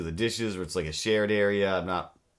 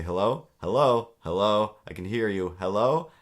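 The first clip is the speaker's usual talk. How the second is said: hellos getting louder, into a near shout; growing louder hellos; 2 semitones higher, 8 dB louder, slower than usual. Retold or imitated. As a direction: call into the phone, louder each time. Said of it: "I say".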